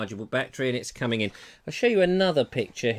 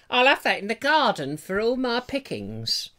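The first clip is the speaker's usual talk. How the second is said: a higher-pitched imitation of Julia, lightly posh; imitating Julia; 8 semitones higher than usual. Husband voicing what Julia will say about the box, in a higher voice. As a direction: put on her voice as she claims the box. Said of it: "She might not speak like that".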